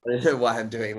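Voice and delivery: usual speaking voice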